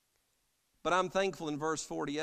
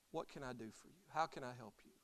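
very soft, lowered offer of help; gentle offer; 3 semitones lower, 11 dB quieter, faster than usual. Acted. Jesus asking the blind man what he needs, gentle. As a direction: ask it softly, ready to serve.

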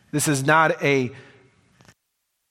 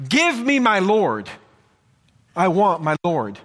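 raised, higher pleading preacher voice; fervent preaching; 8 semitones higher, 3 dB louder than usual. Acted. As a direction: plead it out from the pulpit. Preaching at full force.

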